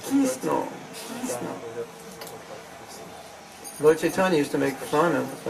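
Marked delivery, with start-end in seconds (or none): 0.1-1.5 put-on Bengali-accented "Krishna", first one high. Mocking how Bengalis say it, Bengali accent. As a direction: mimic a Bengali "Kisno". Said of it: "What to speak of Bengalis?".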